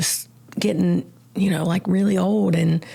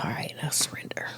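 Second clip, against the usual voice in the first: whispered surrender; 4 dB quieter, faster than usual. Retold or imitated. Giving up to the counselor, whispered surrender.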